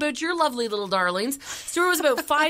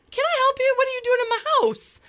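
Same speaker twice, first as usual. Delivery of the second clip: alarmed; 12 semitones higher, 4 dB louder, faster than usual. Retold or imitated. Stranger found in her apartment.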